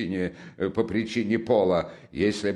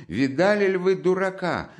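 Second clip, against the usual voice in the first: frantic appeal; 7 semitones higher than usual. A narrator's reading. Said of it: "бросалась она во все стороны".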